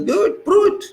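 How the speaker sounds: loud, high mock challenge; voicing a defiant country, loud and high. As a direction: throw it back at them like a dare. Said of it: "But Iran says".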